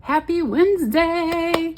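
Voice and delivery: high, slow sing-song hello; cheery greeting. Real, not acted. Vlogger greeting her viewers, high sing-song cheer. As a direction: sing out the weekday greeting brightly.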